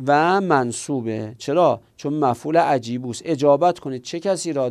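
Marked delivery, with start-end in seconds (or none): none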